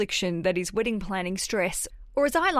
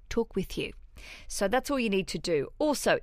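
ordinary talk

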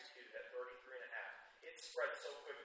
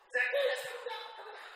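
very loud, high mom holler, laughing through it; mock mama yell, half laughing; 16 dB louder, slower than usual. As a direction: holler it off the porch like a mama calling her kid home. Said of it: "my mama or something yelling".

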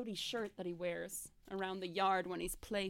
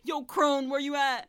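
loud, high comic holler; mock calling out; 5 semitones higher, 8 dB louder than usual. Joking as the knight hunting the crone, calling out. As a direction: shout casually for someone far away.

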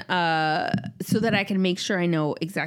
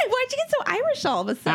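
high teasing question; 13 semitones higher, much faster than usual. Real, not acted. Teasing the sudden accent.

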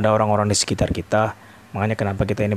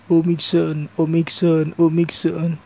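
high, repeated singsong wail; mock-cry the name, pitched way up; imitated crying child; 9 semitones higher, 3 dB louder, much slower than usual. Nephew crying at his uncle's door, imitated wailing. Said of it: "sambil nangis-nangis gitu ya".

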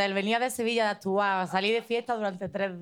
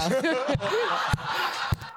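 rising laugh; 6 semitones higher than usual. Real, not acted. Laughs at her own story.

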